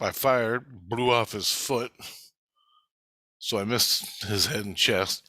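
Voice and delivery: normal talking voice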